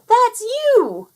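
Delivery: very high, loud squeal; children interrupting their nursemaid joyfully; excited shout. Acted. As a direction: burst in with delight.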